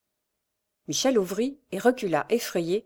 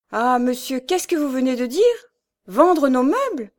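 appalled disbelief; 7 semitones higher, 6 dB louder than usual. A narrator's reading. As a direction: protest as if you can't believe it. Michel appalled at the sale. (stupeur indignée, voix haute)

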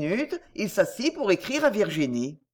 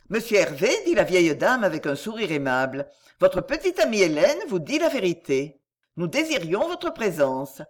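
plain reading voice

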